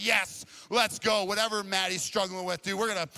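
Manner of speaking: high, loud, hyped shouting; pumped-up rallying yell; fired up. Retold or imitated. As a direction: whip the guys into a frenzy. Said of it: "all fired up".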